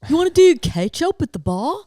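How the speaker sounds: a cheesy Australian guy making a move; bad Australian accent drifting toward British. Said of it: "I can't do an Australian accent".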